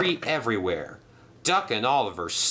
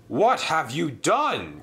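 cried out; 6 semitones higher, slower than usual. Acted. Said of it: "cried Sir Topham Hatt".

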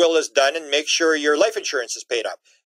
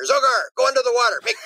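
higher, gleeful voice giving orders; mock alien orders; 6 semitones higher than usual. Alien leader telling Zogar to make bubbles, gleeful and high. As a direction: boss alien egging Zogar on, pitched up.

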